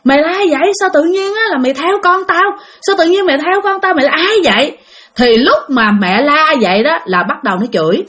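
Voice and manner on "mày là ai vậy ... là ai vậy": high-pitched, mildly raised demand; retold mother's cry. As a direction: imitate a frantic mother challenging a spirit. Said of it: "Lúc này mẹ của bạn mới la lên".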